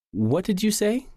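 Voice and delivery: high question climbing to "say"; rising intonation. Shown as a demonstration. Speaker asking someone to clarify, rising intonation. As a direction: ask for clarification, lifting "say" at the end. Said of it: "end with rising intonation when the speaker asks for clarification".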